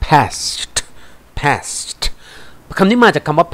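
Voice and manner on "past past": "past" ending on a crisp released T; ED ending demonstration. Shown as a demonstration. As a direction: show learners the final ED sound in "past". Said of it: "เวลาออกเสียง E D เนี่ยนะครับ".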